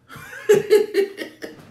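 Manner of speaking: high breathy laugh; airy burst of laughter. Real, not acted. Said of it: "there he is smiling".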